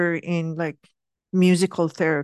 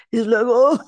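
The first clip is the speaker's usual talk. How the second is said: a loud high "oh" of a cough breaking into a laugh; hammed-up cough noise dissolving into laughter; mock coughing fit; 7 semitones higher, 5 dB louder than usual. Imitating the cousin's coughing fit.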